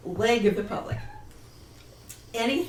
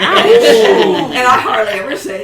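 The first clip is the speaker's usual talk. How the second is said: laughing; 3 semitones higher, 16 dB louder than usual. Real, not acted. Laughs at own harsh remark.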